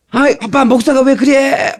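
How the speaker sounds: lightly voiced exasperated daughter; daughter scolding. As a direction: mimic a daughter nagging her dad. Daughter scolds dad's outfit.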